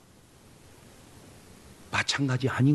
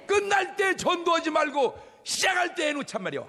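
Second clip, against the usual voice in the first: full-force preaching; 14 semitones higher than usual. Acted. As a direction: rouse the congregation with a high call.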